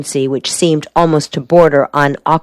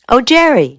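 higher-pitched, pleased greeting; glad exclamation; 8 semitones higher than usual. A narrator's reading. Helen greets the visitor at the door, exclaiming happily. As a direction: exclaim it high with delight. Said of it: "she exclaimed".

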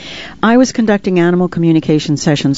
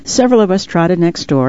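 ordinary delivery

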